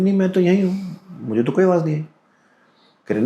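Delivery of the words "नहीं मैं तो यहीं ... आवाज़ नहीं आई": higher, puzzled denial; father denying. Retold or imitated. Imitating the woken father saying he heard nothing. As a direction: brush it off as the sleepy father.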